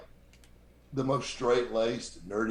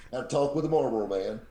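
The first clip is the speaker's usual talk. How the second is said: ordinary talk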